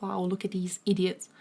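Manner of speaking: usual speaking voice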